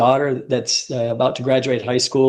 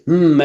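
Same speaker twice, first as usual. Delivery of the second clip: mock hum; 3 semitones higher, 6 dB louder than usual. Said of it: "Bob goes".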